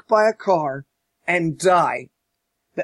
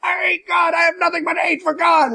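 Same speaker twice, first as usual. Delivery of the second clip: high, shouted mock rage of a villain professor; a hammed-up cartoon villain yell; mock furious outburst; 11 semitones higher than usual. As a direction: ham up the professor screaming his hatred. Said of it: "he's like".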